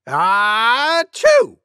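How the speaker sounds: high-pitched storybook sneeze; mock sneeze. Cuckoo guessing how loud an elephant sneezes, mock sneeze. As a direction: build a long "ah", then burst on the "choo". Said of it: "Maybe as loud as this".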